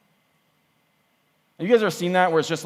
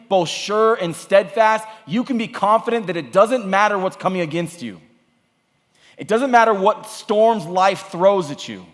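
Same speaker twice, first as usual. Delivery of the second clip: raised, rousing sermon delivery; preaching at full force; 6 semitones higher, 4 dB louder than usual. Real, not acted. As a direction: drive it home with high, emphatic phrases.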